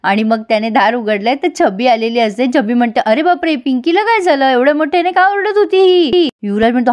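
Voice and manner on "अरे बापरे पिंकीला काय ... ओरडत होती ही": higher, fussy sister-in-law voice; Chhabi's voice. Acted. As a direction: pitch up into a nosy sister-in-law.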